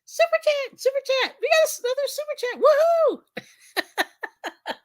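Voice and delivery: very high, loud sing-song announcer; high-pitched chanted fanfare ending in a whoop; Arnie's voice. Retold or imitated. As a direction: squeal out the super chat alert in a high put-on voice. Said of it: "I'm gonna do Arnie's voice".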